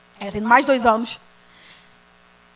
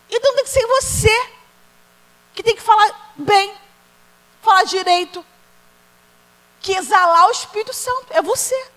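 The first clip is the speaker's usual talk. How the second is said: higher, louder, slowed preaching that drives each line home; full-force preaching; 7 semitones higher, 4 dB louder than usual. Acted. Preacher urging the congregation to change, full-force preaching. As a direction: preach it hard, rising to the climax.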